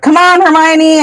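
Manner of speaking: high, urging call; mild retold holler upward. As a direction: call a friend to follow. Calling up to a friend.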